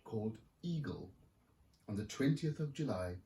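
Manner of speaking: lively picture-book reading; story narration. A narrator's reading. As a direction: read it like to a room of kids. Author reads picture book aloud.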